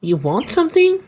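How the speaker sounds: put-on an old gangster lady sizing someone up. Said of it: "I don't know what to give this lady voice".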